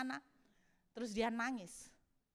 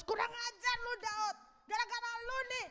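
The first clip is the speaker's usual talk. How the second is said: high-pitched mock outrage of a mob; angry accusation; 10 semitones higher than usual. Angry men turning on Daud, accusing. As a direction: blame him in an indignant, raised pitch.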